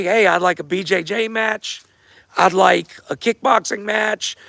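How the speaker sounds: ordinary delivery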